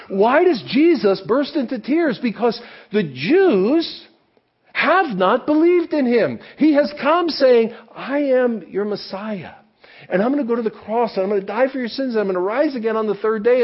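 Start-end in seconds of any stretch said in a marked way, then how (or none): 2.2-13.6 intense preaching. Acted. Preaching Jesus' message intensely.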